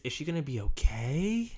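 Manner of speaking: "okay" stretched out and rising; expanded syllables. Shown as a demonstration. Trainer showing the right take, syllables expanded. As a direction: linger on "Is she gonna be okay" with concern. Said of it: "you start expanding those syllables".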